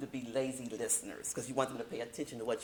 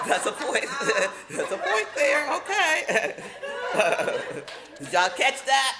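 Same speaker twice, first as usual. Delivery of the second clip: high, loud laughter through the words; laughing; 10 semitones higher, 9 dB louder than usual. Real, not acted. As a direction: laugh out loud while getting the line out. Laughing at a cheeky point.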